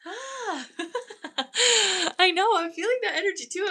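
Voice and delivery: high, loud burst of laughter; laughing excitedly. Real, not acted. Medium overwhelmed by sudden energy, laughing loudly.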